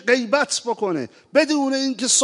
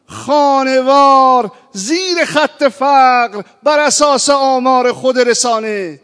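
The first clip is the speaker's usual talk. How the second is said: loud, insistent oratory, each clause hammered; raised, impassioned sermon voice, building to louder; forceful preaching; 2 semitones higher, 6 dB louder than usual. Real, not acted. Preacher urging concern for jobless youth and poor families, forceful.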